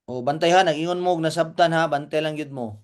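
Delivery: normal talking voice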